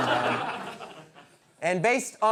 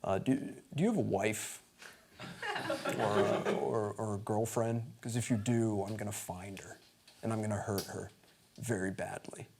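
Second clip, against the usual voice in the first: 1 menacing villain; 8 semitones lower, 9 dB quieter than usual. Retold or imitated. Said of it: "he says".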